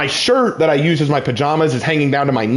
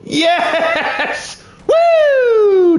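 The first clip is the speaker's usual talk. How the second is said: real gamer triumph shout; excited cheer. Real, not acted. Wins a fight in game.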